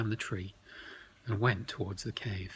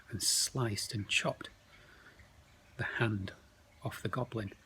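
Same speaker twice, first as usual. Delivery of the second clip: plain reading voice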